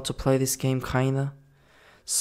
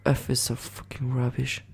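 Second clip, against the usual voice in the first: ordinary delivery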